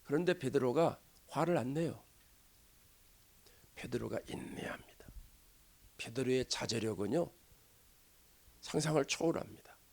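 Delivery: very soft, low, near whisper; hushed restraint. Real, not acted. Preacher showing Peter holding back his anger, hushed and restrained. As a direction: drop right down and let pauses hang.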